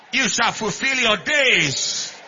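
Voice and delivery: loud, slow, drawn-out pronouncement; forceful slow blessing; emphatic blessing. Real, not acted. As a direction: proclaim it slowly over the people. Blessing repeated, building to a cry.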